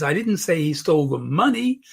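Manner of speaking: "money" lifted at the end; stress on MONEY. Shown as a demonstration. Coach changing the meaning, stress on "money". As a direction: save the weight for "money". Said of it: "just by changing around this".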